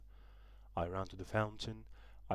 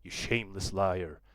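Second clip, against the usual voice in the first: put-on loud, furious yell at the boy; father yells at his lying son in fury; angry yell; 9 dB louder than usual. A narrator's reading. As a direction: hurl the insult at him. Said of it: "and yelled".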